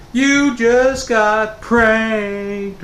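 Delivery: very slow, high drawn-out taunting sing-song; teasing reveal. Retold or imitated. Dad and sister tell the son he was pranked, slow teasing taunt. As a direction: stretch it out to rub it in.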